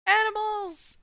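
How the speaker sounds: high, loud burst of excitement; excited. Real, not acted. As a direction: shout it out with delight. Excited reading of a feature.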